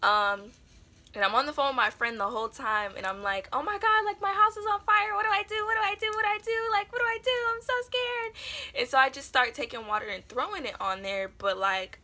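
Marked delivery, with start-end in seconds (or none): 3.5-8.8 high, loud panicked retelling; panicking. Retold or imitated. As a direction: re-enact a frantic plea to a friend on the phone. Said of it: "I was just panicking".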